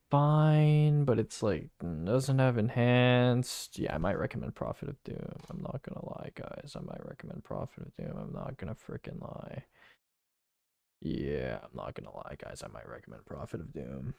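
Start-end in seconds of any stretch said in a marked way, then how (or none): 3.8-5.3 muttering. Real, not acted. Mumbled repeat to himself. 5.5-6.3 muttering. Real, not acted. Loud line repeated quietly. 6.3-9.6 muttering. Real, not acted. Mumbled repeat to himself. 11.0-14.1 muttering. Real, not acted. Mumbled repeat to himself.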